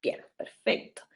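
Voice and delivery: soft whispered aside to a student; hushed approval; whispered praise. Real, not acted. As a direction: whisper the praise, just for her.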